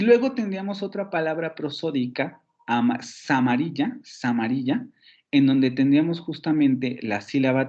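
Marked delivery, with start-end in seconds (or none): 3.0-4.9 "samarilla" as one word, opening on "sa"; resyllabified word. Shown as a demonstration. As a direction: carry the s of "es" onto "amarilla": "samarilla". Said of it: "aquí se silabifica de esa manera". ("samarilla", con la ese pegada a "amarilla")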